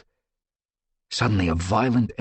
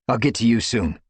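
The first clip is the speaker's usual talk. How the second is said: ordinary delivery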